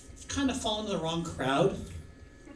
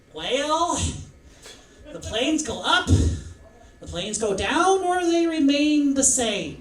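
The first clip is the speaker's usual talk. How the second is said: halting, folksy presidential voice; drawn-out words with pauses and rising tunes; Ronald Reagan; 6 semitones higher, 5 dB louder than usual. Acted. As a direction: answer ponderously, swelling louder and higher at the end. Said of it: "President Reagan, what do you think".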